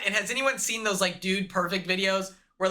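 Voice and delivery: ordinary talk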